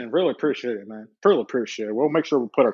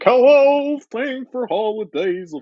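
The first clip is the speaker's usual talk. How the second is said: mock announcer voice; 10 semitones higher, 5 dB louder, slower than usual. Mock TV singing greeting.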